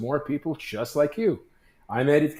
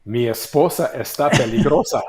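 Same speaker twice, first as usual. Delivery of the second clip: put-on louder, sultry Spanish accent; sexy Spanish accent; 6 dB louder than usual. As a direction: purr it like a Latin lover, Spanish accent. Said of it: "I want [name] to speak in a sexy Spanish accent".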